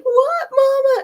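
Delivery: high, slow, teary plea; mock tearful daughter. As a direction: daughter in tears at the deathbed, crying out.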